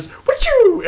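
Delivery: high, loud comic "achoo" sneeze; mock sneeze sound. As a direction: blurt a cartoon sneeze. Damien throws arrow back again.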